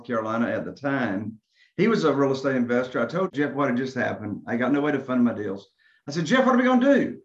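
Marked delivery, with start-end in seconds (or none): 6.2-7.2 desperate plea. Retold or imitated. Desperate call to friend.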